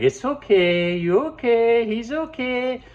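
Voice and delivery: put-on high, loud baby-talk reassurance; cooing owner. Owner cooing at nervous dog, high baby talk. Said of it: "you like".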